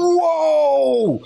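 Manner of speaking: long, high drawn-out holler; big hammed-up shock; astonished outburst. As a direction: replay being floored, stretched and high. Retold shock at the accent.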